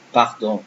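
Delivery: "pardon" with open French A and throaty R; French pronunciation. Shown as a demonstration. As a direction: say "pardon" the French way, pahr-DON with nasal ending. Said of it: "See, I say pardon".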